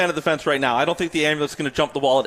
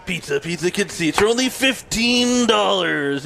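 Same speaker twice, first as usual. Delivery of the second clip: slow, stretched-out announcer hype; monster truck ad; 4 semitones higher, slower than usual. Retold or imitated. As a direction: sell it like a retro monster truck commercial. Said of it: "when they used to come to Skydome".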